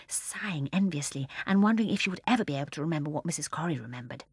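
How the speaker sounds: plain reading voice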